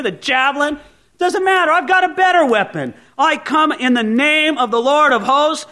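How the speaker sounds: high, loud, defiant challenge; bold young David. Acted. David defying Goliath, bold and loud. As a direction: fling it at the giant with defiance.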